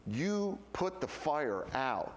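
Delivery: slow, deliberate, matter-of-fact; slow firm. Retold or imitated. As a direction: spell it out slowly, no drama. Rona's firm, slow reply.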